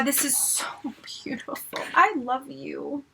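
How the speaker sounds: hushed, breath-only wonder; awed whisper. Real, not acted. Fan in love with the mirror, awed whisper. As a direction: whisper it, overcome.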